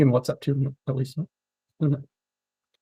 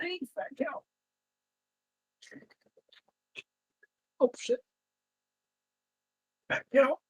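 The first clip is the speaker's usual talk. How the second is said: very high, soft teasing of the cat; squeaky high mock insult for the pet; 11 semitones higher, 11 dB quieter than usual. Real, not acted. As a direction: tease the cat with affection.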